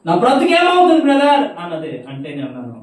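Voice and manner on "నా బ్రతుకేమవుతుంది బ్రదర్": high, loud wailing lament; despairing caller. Retold or imitated. The woman crying to the brother about her ruined life, loud wailing lament. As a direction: wail in hopeless despair.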